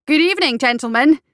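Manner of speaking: calling out loud and high in greeting; a bright, loud hail to the sentries; loud cry. A narrator's reading. Doctor hails the guardsmen to distract them: loud, high cry. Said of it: "he cried loudly".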